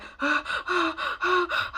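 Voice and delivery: a sharp, high gasp for air; acting out a panicked gasp; reenacted panicked gasp. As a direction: gasp like you can't breathe. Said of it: "I was like".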